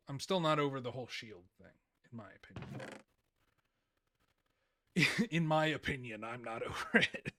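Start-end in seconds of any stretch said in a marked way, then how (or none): 5.0-7.3 cracking up at your own remark; amused laughing. Real, not acted. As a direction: laugh through the words. Laughs at his own phrasing.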